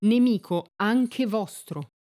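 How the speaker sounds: growing anger, every word stretched out; narrated angry carabiniere driving it home; getting angry. A narrator's reading. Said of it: "spiegò il carabiniere adirandosi".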